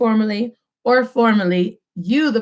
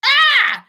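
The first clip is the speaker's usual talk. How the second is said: high mock shriek; 3 dB louder than usual. Teacher reacts to wrong take.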